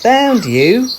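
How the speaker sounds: high, loud triumphant mum; a playful mum catching her child; Mum's voice. Acted. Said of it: "said Mum".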